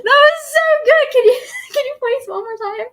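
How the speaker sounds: high, loud, giggling praise; shrieking with laughter; delighted laughter. Real, not acted. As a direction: squeal with delight.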